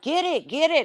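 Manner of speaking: imitating sister begging someone to grab the turtle; panicked mock squeal. Sister pleading with sibling to catch turtle, mock panic.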